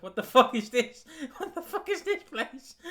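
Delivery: high, laughing incredulous question; cracking up in disbelief; giggling disbelief. Retold or imitated. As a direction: laugh through the baffled reaction. Giggling reaction to train announcement.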